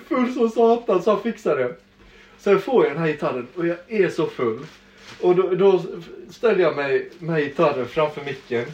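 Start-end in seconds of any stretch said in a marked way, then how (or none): none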